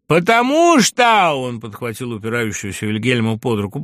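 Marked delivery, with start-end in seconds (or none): 0.1-1.3 high, loud, drawn-out cry; slow, emphatic high yell; impassioned shout. A narrator's reading. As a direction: stretch the words out at full voice. Agitated friend declaring himself.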